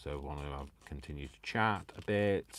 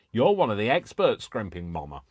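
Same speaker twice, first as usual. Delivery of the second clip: mock telling-off; 5 semitones higher, 12 dB louder than usual. Teasing reply to chat comment.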